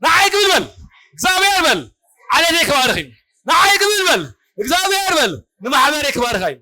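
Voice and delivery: high, forceful call to repeat; fervent call-and-response; rallying preacher shout. Real, not acted. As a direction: cry out each phrase for the crowd to echo. Preacher rallies congregation to repeat after him.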